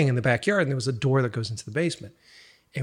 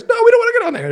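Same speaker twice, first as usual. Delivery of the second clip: put-on kids' whiny refusal; 10 dB louder, much faster than usual. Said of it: "he was just like".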